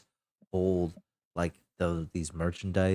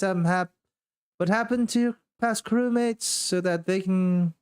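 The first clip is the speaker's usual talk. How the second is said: much higher, businesslike hostess; Elaine's brisk welcome; 11 semitones higher than usual. Acted. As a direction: hurry them in to get it over with. Elaine ushers crew inside.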